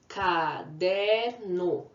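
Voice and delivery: "caderno" said very slowly, syllable by syllable; slow syllable demo. Shown as a demonstration. Teacher slowly sounding out an example word. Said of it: "Como a palavra comida".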